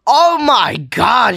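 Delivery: high, loud, drawn-out yell; exasperated shout. Real, not acted. Reactor yelling at a too-loud video, exasperated shout. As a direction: shout them down, dragging out each word.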